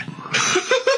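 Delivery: airy high-pitched laugh; wheezy little chuckle; breathy high giggle. Real, not acted. [name] laughs at his own story.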